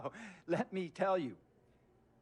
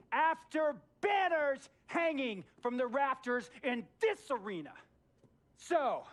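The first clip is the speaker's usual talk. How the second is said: building rant; 7 semitones higher than usual. Acted. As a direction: wind yourself up step by step. Owner ranting, rising pitch.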